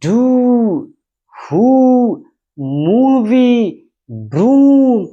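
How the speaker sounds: डू, हु, मूवी, ब्रूम high and loud, each oo held; drawn-out model words. Shown as a demonstration. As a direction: give time to every long oo. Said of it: "इस पर समय दे रहे हैं". (हर लंबे ऊ को खींचकर ऊँचे स्वर में)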